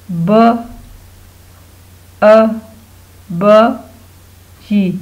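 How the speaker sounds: ब, अ, ब, ई slowly, each sound alone; sounding out spelling. Shown as a demonstration. Teacher repeating the spelling for pupils, sounding out. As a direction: separate ब, अ, ब, ई with long pauses. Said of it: "अब आप इसकी spellings लिखें".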